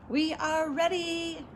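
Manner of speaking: high, slow singsong call. A narrator's reading. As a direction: announce it with drawn-out words. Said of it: "called Amelia Bedelia".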